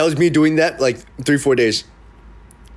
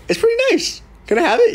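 much higher, hopeful request; high eager ask. Retold or imitated. Vlogger mimics himself asking landlord for apartment, high eager. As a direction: sound like a kid asking for a toy.

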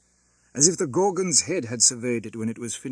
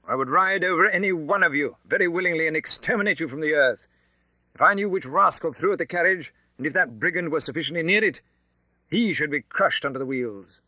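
higher, louder aristocratic menace; cold haughty Marquis; 5 semitones higher, 5 dB louder than usual. Acted. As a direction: threaten the peasants with contempt. Marquis threatens the crowd.

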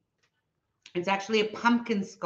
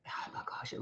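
breathless whisper; 18 dB quieter than usual. Real, not acted. Whispered shock at a biscuit-like scone. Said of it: "unvoiced whisper".

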